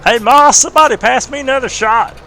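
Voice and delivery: high, loud rowdy hillbilly holler. Acted. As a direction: call to Ma across the bar. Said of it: "do that voice".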